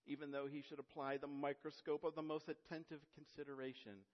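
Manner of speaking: earnest, oratorical reading of Spurgeon; formal quotation reading. Acted. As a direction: read the old preacher's words with conviction. Reads Spurgeon quotation aloud.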